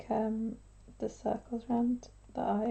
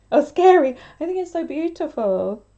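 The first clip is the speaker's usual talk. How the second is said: startled exclamation; 7 semitones higher, 10 dB louder than usual. Real, not acted. Reacting to the doll.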